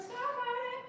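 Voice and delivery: very high squeal on Stop it; squeaky protest. Real, not acted. Higher repeat of protest.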